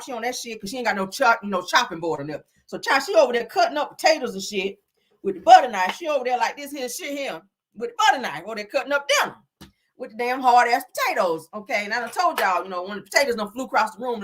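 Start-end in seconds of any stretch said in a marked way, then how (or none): none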